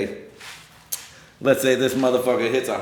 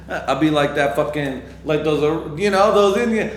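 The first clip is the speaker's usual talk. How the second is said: ordinary talk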